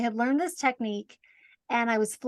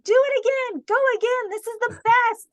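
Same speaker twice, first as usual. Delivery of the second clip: put-on much higher and louder, gushing like a kid on a ride; mock thrilled; 11 semitones higher, 5 dB louder, faster than usual. Guest reliving her in-flight self-talk, hammed-up and much higher. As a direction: ham up the fun to fool your fear. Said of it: "I was saying".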